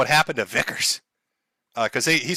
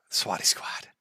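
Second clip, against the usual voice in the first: breathy little whisper of the name; 9 semitones lower, 8 dB quieter, slower than usual. Acted. Streamer whispering his new merch name to chat. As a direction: hush it like a secret slogan.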